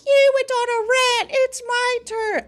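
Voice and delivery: high, sing-song whiny mimicry; mocking high voice. Mocking Wonder Woman claiming her turn to rant. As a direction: parrot her in a shrill accusing voice. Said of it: "she was like".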